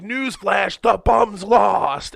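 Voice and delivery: hammed-up angry rich man's yell; imitated yelling. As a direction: hammer out each word, slowing and swelling. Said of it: "when he was yelling at him".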